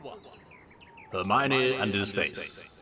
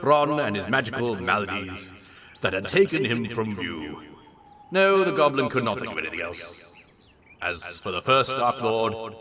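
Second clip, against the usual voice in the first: ordinary talk, nothing marked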